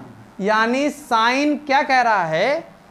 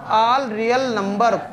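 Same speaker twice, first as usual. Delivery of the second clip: slow recital; much slower than usual. Shown as a demonstration. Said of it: "domain क्या है".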